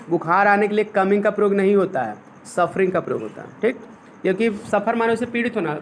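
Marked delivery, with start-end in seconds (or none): none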